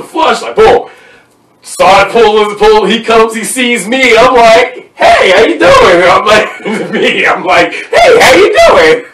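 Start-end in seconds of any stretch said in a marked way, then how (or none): 5.0-6.0 much higher, louder, overly cheerful hello; greeting the security guard with a nervous, high, fake-friendly hello; fake innocent greeting. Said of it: "Literally, that's what I say". 7.7-9.1 very high, loud, cheery hello broken by laughter; laughing while re-enacting the high, nervous greeting to security; fake innocent greeting. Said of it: "Literally, that's what I say".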